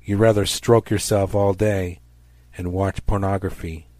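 ordinary delivery

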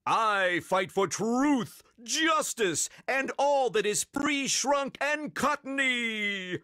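high, slow heroic oath; Captain Underpants. A narrator's reading. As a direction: declaim it grandly, stretching the last word. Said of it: "said Captain Underpants".

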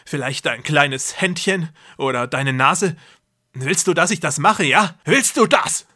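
loud and grandiose, playing a god; deranged brother. A narrator's reading. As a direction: brother lecturing his trapped sister. Jakob threatening sister.

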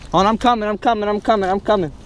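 rushing over to help, voice lifted; excited calling out. Real, not acted. Hurrying to a friend's hooked fish, excited.

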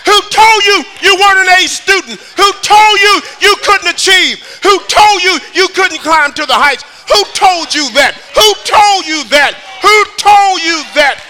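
high, loud, shouting sermon climax; preaching at full force. Acted. Preacher urging the church to run to God, shouting. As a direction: drive it home to the congregation.

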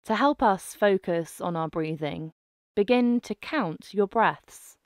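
normal talking voice

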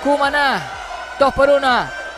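excited score call; breakneck goal commentary. Real, not acted. Crowd chant, score repeated. (grito de gol emocionado)

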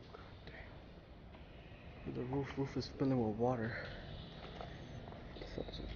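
ordinary talk, nothing marked

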